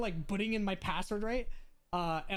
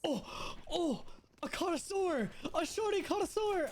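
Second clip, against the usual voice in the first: excited high mock praise; 10 semitones higher than usual. Real, not acted. Teasing praise of teammate.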